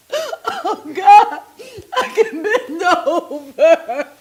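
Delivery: cry-laugh at the cruel irony; laughing through tears. Real, not acted. Grieving after losing her baby.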